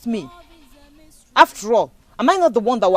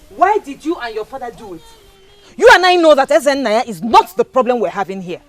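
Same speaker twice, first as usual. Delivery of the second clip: accusing; 10 semitones higher, 8 dB louder than usual. Acted. Accusing lover of betrayal.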